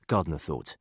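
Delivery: usual speaking voice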